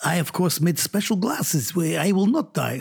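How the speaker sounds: a hint of Russian as the mad doctor; Russian doctor accent. Schoolboy on stage to the assembly, light mock Russian accent. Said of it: "And I say".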